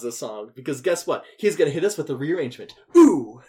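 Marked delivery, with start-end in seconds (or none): 3.0-3.4 excited ooh. Real, not acted. Hyping the rearrangement.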